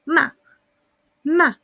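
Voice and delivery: MA short and strong, falling sharply; Ma as a brief, loud, high-starting drop; fourth tone. Shown as a demonstration. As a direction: hit Ma hard and cut it short. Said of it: "es bastante cortito y fuerte".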